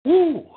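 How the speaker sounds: a sharp, high, loud woo to blow off the run; high breathless whoop. Real, not acted. Worn out streamer lets out a high, loud whoop. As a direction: whoop out the exhaustion. Winded after running, whooping exhale.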